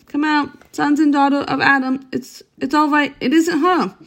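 put-on Mister Beaver calling the children out, excited; excited shout. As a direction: call them out breathless with joy. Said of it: "he was shouting".

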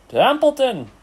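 high, loud scream of a name. A narrator's reading. Pig waking the sleeping rat, screaming. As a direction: scream to wake him. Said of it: "screamed Wilbur".

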